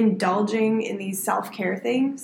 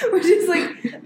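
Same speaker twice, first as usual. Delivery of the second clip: laughing; 8 semitones higher, 4 dB louder, slower than usual. Real, not acted. Laughing at Viking joke.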